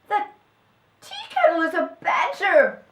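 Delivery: high, loud shout of discovery; astonished cry. A narrator's reading. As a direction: cry it out in amazement. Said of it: "cried the other helper".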